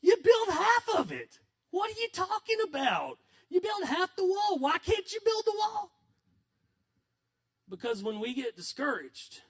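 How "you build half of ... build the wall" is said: high, loud incredulous shouting; exasperated rebuke. Acted. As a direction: scold the workers as if they're crazy to quit. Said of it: "if I was Nehemiah, I'd look and go".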